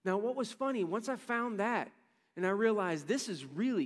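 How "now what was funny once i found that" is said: ordinary delivery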